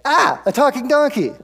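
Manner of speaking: high, loud startled cry; hammed-up alarmed yell; mock shock. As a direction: pretend to freak out, voice leaping up. Imagined shock at a talking donkey.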